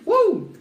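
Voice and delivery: loud high-pitched woo; high whoop. Real, not acted. Cheering against all odds.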